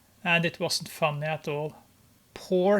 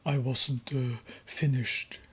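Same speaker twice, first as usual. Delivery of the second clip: sulky Grace; 4 semitones lower than usual. Acted. Grace voiced, sulky reply.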